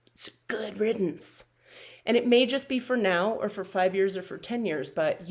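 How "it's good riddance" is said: breathy unvoiced whisper. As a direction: whisper it like a secret aside. Whispered aside.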